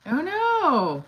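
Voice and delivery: high, loud "oh no"; surprised. Real, not acted. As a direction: gasp it out in mock dismay. Reacting to a chat comment.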